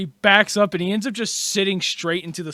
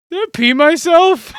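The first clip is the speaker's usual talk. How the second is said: loud, high-pitched alarm; acted shocked realization; startled question; 10 semitones higher, 9 dB louder than usual. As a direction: blurt it out in dismay. Crying character's startled discovery.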